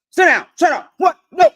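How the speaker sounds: loud, high mock bark of orders; harsh parent barking commands; barking at kids. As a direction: snap orders at a child like a drill sergeant. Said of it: "that's barking".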